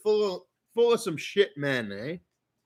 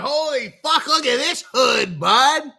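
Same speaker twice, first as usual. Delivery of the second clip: higher, louder astonished exclamation; amazed exclamation; 4 semitones higher, 4 dB louder than usual. Retold or imitated. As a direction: gape at the neighborhood out loud. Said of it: "I was like".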